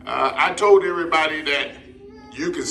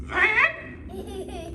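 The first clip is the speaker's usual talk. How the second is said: very high, softer parrot voice; parrot echo; 5 dB quieter than usual. Acted. Parrot puppet repeating syllables after its teacher, high voice. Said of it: "Say van".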